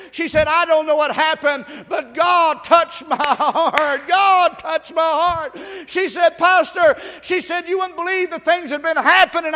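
loud testimony retold with long-drawn key words; impassioned preaching. Real, not acted. Pastor retelling how God touched her heart, impassioned preaching. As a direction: cry out the testimony to the congregation.

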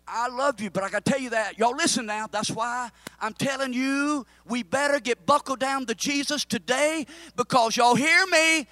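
loud, high fervent preaching; preaching at full force. Acted. Preacher to congregation, loud fervent preaching.

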